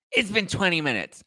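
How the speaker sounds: high-pitched customer whine; put-on high indignation; mock indignant complaint. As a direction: imitate a fussy customer complaining. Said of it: "he's going off on her".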